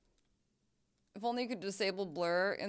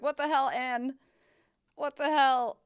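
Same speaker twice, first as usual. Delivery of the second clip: higher, louder, exasperated at the actress; mock outrage; 6 semitones higher, 6 dB louder than usual. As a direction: throw up your hands in disbelief. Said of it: "I was like".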